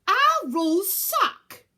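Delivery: loud slow high outburst; blurt. Acted. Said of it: "Virgo blurted out".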